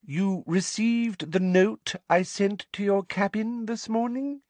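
high, slow, patronizing official; as if addressing someone foreign and very slow; over-articulate each word in a high voice; loud slow condescension. A narrator's reading. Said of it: "loud, slow voice she had used".

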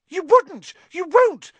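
very high, loud panicked squeal; horrified gasp. A narrator's reading. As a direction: gasp it shrilly in terror. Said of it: "gasped Pettigrew".

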